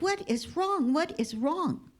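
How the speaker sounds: a hint of urgent concern; concerned rabbi. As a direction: lightly voice a rabbi asking a crying friend. Rabbi questions crying friend.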